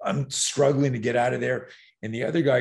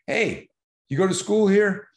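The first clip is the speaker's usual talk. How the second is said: high, loud call across the lot; teen calling out; 10 semitones higher, 5 dB louder than usual. Retold or imitated. Older teen calls to a kid, loud and high. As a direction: hail the younger kid from a distance.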